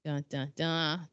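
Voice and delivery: usual speaking voice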